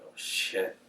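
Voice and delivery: very soft, low aside to yourself; hushed inner thought. Retold or imitated. Player thinking to himself as coach gathers everyone, hushed. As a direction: drop it to a near-whisper.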